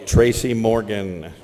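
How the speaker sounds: usual speaking voice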